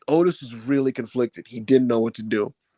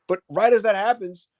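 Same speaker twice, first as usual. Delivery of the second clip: ordinary talk, nothing marked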